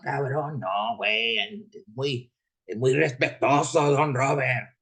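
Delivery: high, drawn-out Mexican Spanish homie voice teasing a crybaby; homie's Mexican slang voice. Retold or imitated. Driver mocks upset Chiefs fans in a Mexican Spanish homie voice. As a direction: needle him in a Mexican Spanish homie voice, don't cry, it's just a game. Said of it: "a homie that used to talk like that".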